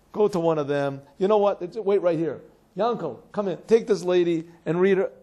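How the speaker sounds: loud, brisk call to a pupil across the room. Retold or imitated. Rabbi calls a pupil over.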